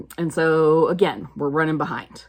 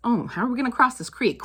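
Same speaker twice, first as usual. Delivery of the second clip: mock worry; 7 semitones higher, faster than usual. Mock panic at the creek.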